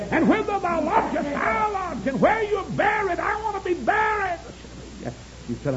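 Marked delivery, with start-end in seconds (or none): 0.1-4.4 high, sustained pulpit cry; high-pitched preaching chant; impassioned preached vow. Acted. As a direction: declaim it high like a revival preacher. Preaches Ruth's vow at full force.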